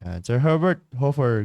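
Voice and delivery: normal talking voice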